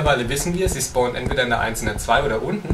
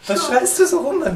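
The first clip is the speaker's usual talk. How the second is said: high, startled complaint; aufgeschreckt; 4 dB louder than usual. Real, not acted. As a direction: protest in a much higher voice after a fright. Startled by partner's sudden scream. (erschrocken und hoch)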